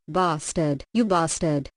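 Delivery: ordinary delivery, nothing marked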